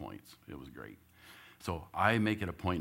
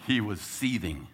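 ordinary delivery

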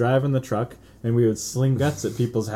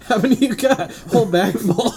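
higher, laughing soldier voice; mock soldier banter; 10 semitones higher, 5 dB louder than usual. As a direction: play a corpsman counting. Corpsmen acted dialogue.

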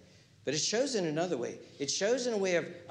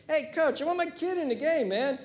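mock a parent demanding playing time; hammed-up complaining sports dad; pushy parent; 11 semitones higher than usual.

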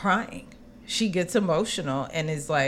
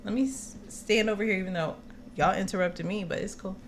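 sarcastic aside; 7 dB quieter, faster than usual. Retold or imitated. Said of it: "very dismissive".

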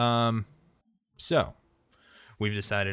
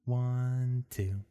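put-on creepy child; 4 semitones higher, slower than usual. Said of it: "If you start singing in a creepy little girl voice".

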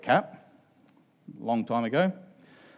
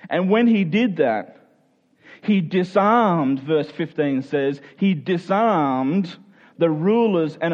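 rising, louder preacher's build through the climax; preaching at full force; 5 semitones higher, 3 dB louder than usual. Acted. Pastor to congregation about the cross, preaching with building force. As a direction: drive it home to the congregation with growing force.

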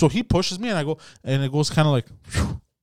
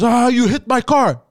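higher, louder, slow accusing; mock accusation; 9 semitones higher, 6 dB louder than usual. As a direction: drag out the accusation. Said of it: "So he goes".

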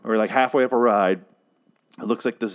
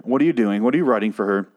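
normal talking voice

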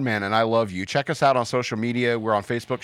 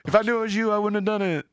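sheepish road rager; 10 semitones higher, faster than usual. Retold or imitated. Road rager continues apology.

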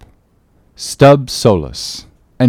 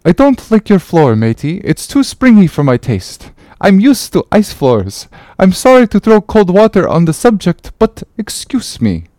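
higher, lightly voiced dry refusal; grumbling Icelandic sailor; 9 semitones higher than usual. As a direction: decline the dance drily.

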